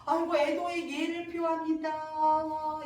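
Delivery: slow wailing chant on held notes; teasing mock condolence. So Unseong offering sham condolences to Songgi, teasing. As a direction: intone fake mourning, stretching every ending. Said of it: "이러면서 약 올리는 거야".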